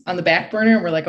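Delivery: normal talking voice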